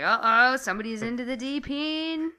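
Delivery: high-pitched mock-alarmed glee; playful teasing excitement. Reacting to the whispered invitation, playful excited teasing. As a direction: play up the gleeful tease, pitched well up. Acting out her gleeful reaction.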